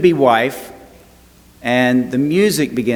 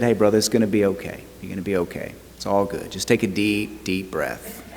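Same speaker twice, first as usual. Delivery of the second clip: soft, low, hushing reassurance to a nervous groom; a pastor soothing the groom as the bride comes down the aisle, softly reassuring; calm reassurance; 4 semitones lower, 7 dB quieter than usual. Retold or imitated. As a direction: talk him down gently and quietly. Calming panicking groom at altar.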